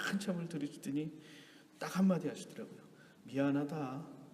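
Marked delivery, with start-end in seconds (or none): 3.2-4.2 low, soft retold apology; imitated father's hushed sorry; subdued apology.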